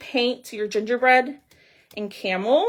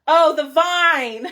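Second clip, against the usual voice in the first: sudden realization; 6 semitones higher, 8 dB louder, much slower than usual. Real, not acted. Realizes what viewers mean.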